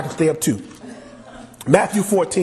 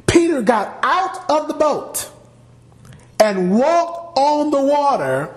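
building excitement; 9 semitones higher than usual. Acted. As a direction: paint Peter stepping onto the water. Preacher dramatizes Peter's walk.

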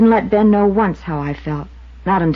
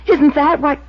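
much higher and louder, words tumbling out; half shouting it, upset he made her say it; agitated blurting; 10 semitones higher, 7 dB louder, slower than usual. Acted. Waitress blurting the acquittal to the investigator, high and loud.

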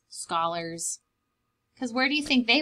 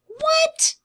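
amazed; 7 dB louder than usual. Acted. Amazed reaction to the ink.

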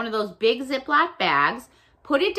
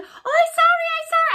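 very high, flustered mock apology; apologizing shopper; 5 dB louder, faster than usual. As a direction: imitate another shopper apologizing in a squeaky-high voice.

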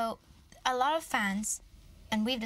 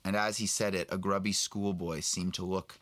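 put-on old Aberforth; 13 semitones lower than usual. Reading Aberforth's memory of his sister in a much lower voice. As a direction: sink deep into a weary old wizard.

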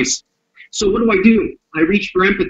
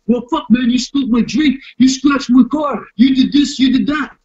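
aggrieved complaint; 3 semitones higher than usual. Retold or imitated. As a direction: complain hotly about the damage done. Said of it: "tell you how you can help him".

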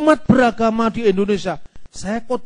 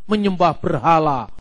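ordinary delivery

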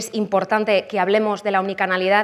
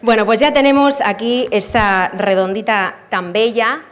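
usual speaking voice

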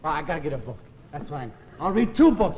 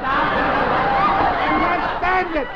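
slow, high, loud drawn-out cry; anguished wail; 7 semitones higher, 8 dB louder, much slower than usual. Acted. Desperate man wailing to his doctor on the phone. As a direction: let the despair spill out.

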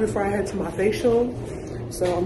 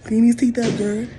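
sassy loud demand; 4 semitones higher, 6 dB louder than usual. Retold or imitated.

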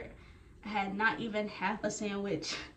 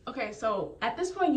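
usual speaking voice

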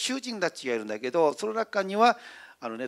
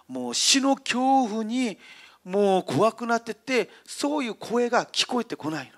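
ordinary delivery

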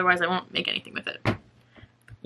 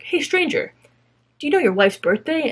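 street interviewer; 6 semitones higher than usual. Retold or imitated. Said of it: "street interview things where they're like".

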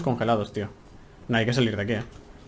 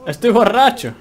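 put-on high, loud drunken exclaim; mock drunk; 9 semitones higher, 8 dB louder, slower than usual. Player hamming up being drunk after wine, loud and high. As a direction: play the tipsy hero, loud and high. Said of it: "Le ha venido la borrachera".

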